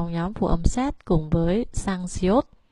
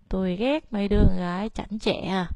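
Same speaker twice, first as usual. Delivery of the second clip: ordinary talk, nothing marked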